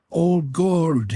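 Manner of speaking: half-voiced plea of despair; moaning. A narrator's reading. Tippett to God, a mild narrated moan. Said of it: "he moaned".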